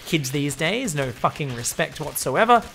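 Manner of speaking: ordinary talk, nothing marked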